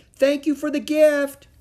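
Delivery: high, loud call of thanks; shouted thanks. A narrator's reading. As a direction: holler thanks to someone far away. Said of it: "she shouted".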